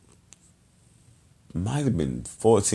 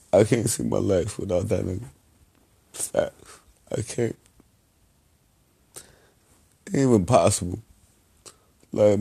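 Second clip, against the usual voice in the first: choked up; 3 dB quieter than usual. Real, not acted. Breaking down over his cousin.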